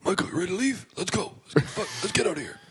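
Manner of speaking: Malcolm Marius impression. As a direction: call him out the door in a mock nagging voice. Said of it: "Do it one again".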